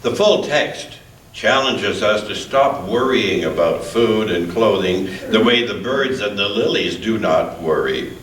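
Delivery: normal talking voice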